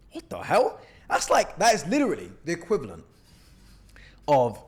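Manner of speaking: high, loud disbelief; shocked outburst. Real, not acted. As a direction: blurt it out, stunned by the message. Shocked by presumptuous DM.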